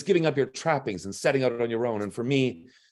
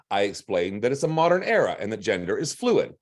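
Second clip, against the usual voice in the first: ordinary delivery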